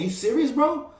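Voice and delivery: high pitched, incredulous; shocked, high and disbelieving; disbelieving question. Retold or imitated. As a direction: ask it like you can't believe it. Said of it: "So I kept saying".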